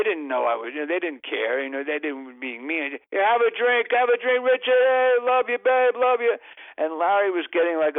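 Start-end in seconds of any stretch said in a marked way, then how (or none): 3.1-6.3 higher, louder rowdy fan calls; rowdy drunk fans. Retold or imitated. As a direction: egg him on like a boozy stadium crowd. Drunk fans offering drinks.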